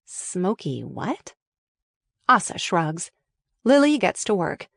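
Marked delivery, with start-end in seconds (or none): 0.0-1.3 softer, slow confused inner voice; puzzled voiceover. A narrator's reading. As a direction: muse it quietly to yourself. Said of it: "Asa, voiceover".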